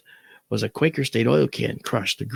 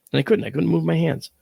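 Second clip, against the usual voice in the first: ordinary talk, nothing marked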